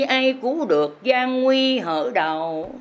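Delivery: usual speaking voice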